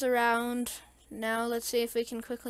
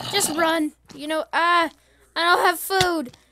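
loud, higher, flustered gamer panic; panicked; 6 semitones higher, 8 dB louder than usual. Real, not acted. Gamer overrun by mobs with no food, loud panic. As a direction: blurt it out while under attack.

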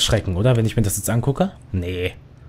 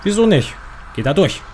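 panicked; 6 semitones higher than usual. Real, not acted. Panicked escape from monster.

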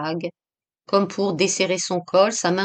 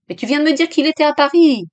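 high, loud, fast outburst; frantic protest; 8 semitones higher, 6 dB louder, faster than usual. A narrator's reading. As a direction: protest in rising panic. (protestation affolée, rapide et aiguë)

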